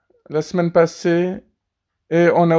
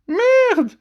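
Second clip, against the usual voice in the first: put-on high baby voice. Baby swears at dad.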